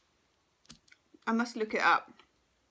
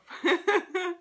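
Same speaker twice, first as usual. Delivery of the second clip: short laugh; 9 semitones higher, 5 dB louder than usual. Real, not acted. Laughs at the Death card.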